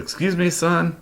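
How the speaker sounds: high, pointed "excuse me, son?" in a put-on voice; indignant agent. FBI agent scolding the insulting suspect, high and indignant.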